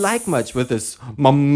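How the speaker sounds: ordinary talk, nothing marked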